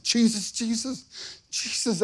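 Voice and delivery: high, soft imitation of sobbing; retold sobbing plea. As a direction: reenact a helpless repeated cry. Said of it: "I was just sobbing".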